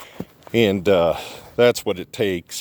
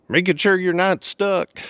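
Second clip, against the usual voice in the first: imitating a friendly driver, pitched higher; higher mock voice of a concerned neighbour; passing driver; 9 semitones higher than usual. A driver checking on the stuck truck, friendly and higher. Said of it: "He's like".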